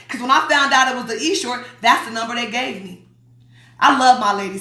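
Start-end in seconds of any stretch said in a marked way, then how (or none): none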